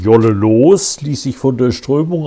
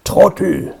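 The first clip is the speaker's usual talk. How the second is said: sharp snarl, higher pitch; snapping; 9 semitones higher than usual. A narrator's reading. Alfred snapping back at Heinz, spitting. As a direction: snap it back at him. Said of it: "fauchte Alfred".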